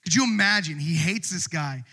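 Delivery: normal talking voice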